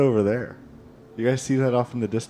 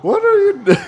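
high silly voice; 7 dB louder, much faster than usual. Acted. NPC voice.

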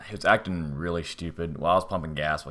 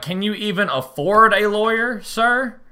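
higher, sneering question; mocking jab; 12 semitones higher, 6 dB louder, slower than usual. Teller mocking the stranger's lawyer threat, higher sneer. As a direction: needle him with fake politeness.

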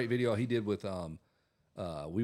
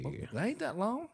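mock protest; 10 semitones higher, 3 dB quieter than usual. Playing along with the phone call.

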